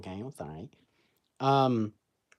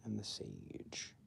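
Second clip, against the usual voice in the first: whispered; 18 dB quieter than usual. Real, not acted. Quiet whispered aside.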